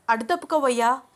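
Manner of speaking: woman irritated at the man blocking the door. Acted. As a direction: get out of my way. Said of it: "అంది అనన్య చిరాకు పడుతూ".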